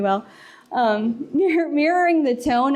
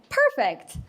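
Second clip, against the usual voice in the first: bright reply; 9 semitones higher than usual. Shown as a demonstration. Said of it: "you can be".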